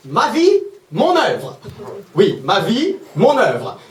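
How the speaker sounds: slow, pompous caption reading with big pitch swings; theatrically swooping, mocking announcement; mock grandiose. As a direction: draw it out like an overblown title.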